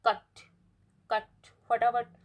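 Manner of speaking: कट said short and crisp, quick clipped vowel; short vowel demo; short clear vowel. Shown as a demonstration. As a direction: snap the vowel in कट out fast. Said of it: "clear कट निकल जाता है".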